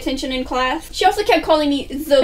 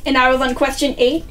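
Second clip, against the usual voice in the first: usual speaking voice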